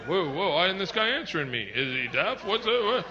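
lightly voiced baffled cop; puzzled officer getting no answer; confused cop. Cop ignored by silent driver, mildly baffled. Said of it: "He's confused, disoriented".

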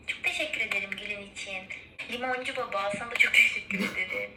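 normal talking voice

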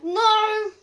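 sharp loud no. Real, not acted. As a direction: shout it. Loud denial.

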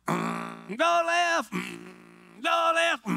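high, droning engine-like voice; hammed-up steering chant between engine noises; mock racing driver. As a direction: play at driving round the oval. Mock practice of nascar driving.

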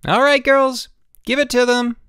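very high, loud rallying shout; rally the troops to attack; shouted command. A narrator's reading. Kathy setting her work crew on the boys, shouted command. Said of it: "Then she shouted".

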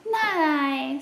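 light mouse squeak refusing; tiny mouse voice. Acted.

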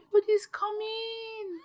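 gasp, then high-pitched wail; high, horrified whine; dismayed cry. Real, not acted. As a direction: wail in dismay at who is arriving. Horrified as Sims gather to mourn.

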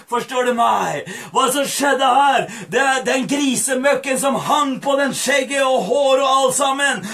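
ordinary delivery

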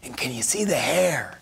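unvoiced teasing whisper. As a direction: whisper it close and sly. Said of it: "He's like".

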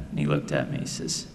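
usual speaking voice

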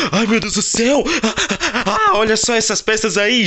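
high, gasping and thrilled, with a laugh; amazed gasp. Acted. Kid gasping at the new ninja pieces, thrilled.

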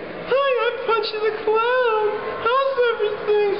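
very high, giggly clown voice; squeaky cartoon clown laughing; Punch the Clown voice. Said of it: "I did a couple of things".

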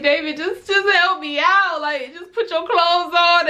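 high, strained mock-tearful plea; re-enacted overwhelmed pleading; flustered pleading, retold. Acting out pleading while crying.

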